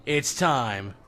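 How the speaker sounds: low slow dramatic announcer; booming kids show opener. Acted. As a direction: draw it out like a showman. Dramatic segment intro.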